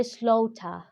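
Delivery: "slaughter" ending in an open "ah", no r; British pronunciation. Shown as a demonstration. English teacher showing learners British speech, "slaughter" without the final r. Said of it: "this is a British pronunciation".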